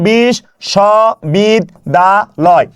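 বিশ্ববিদ্যালয় split into five slow beats, a short pause after each; syllable by syllable. Shown as a demonstration. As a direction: sound out বিশ্ববিদ্যালয় one syllable at a time. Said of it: "কিভাবে থেমে থেমে উচ্চারণ করলাম". (বিশ্ববিদ্যালয় থেমে থেমে উচ্চারণ)

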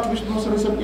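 normal talking voice

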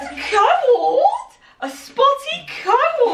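high, slow incredulous question; disbelieving, drawn out; surprised. Real, not acted. Mock surprise at outfit.

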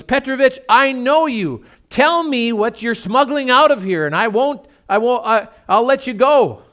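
high, loud begging guard; plead, worn out with curiosity; exasperated pleading. Retold or imitated. Frustrated guard begging the worker to confess, high and loud.

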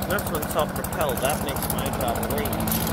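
loud. Real, not acted. Shouting over running mower.

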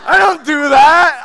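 high, slow shout through laughter; laughing shout. Retold or imitated. Retelling the denial to the audience, shouting with laughter. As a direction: yell it, cracking up.

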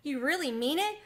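barely holding in his joy; thrilled mouse checking the offer is real; excited. A narrator's reading. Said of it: "could scarcely contain his excitement".

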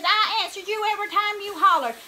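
loud, high-pitched retort; retold scolding mother. Mama to child while whipping, loud high scolding. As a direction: fire back, high and loud.

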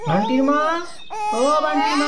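calling out. Acted. Husband calling wife from afar.

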